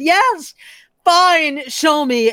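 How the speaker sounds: high, loud giving-up cry; desperate shout. Acted. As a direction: yell it out in defeat. Terrified man gives up.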